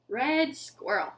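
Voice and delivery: ordinary delivery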